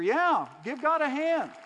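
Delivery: loud, high-pitched cheer to the crowd; rally the congregation to applaud, voice lifted high; rousing call. Real, not acted. Preacher asking the congregation to give God a hand, rousing call.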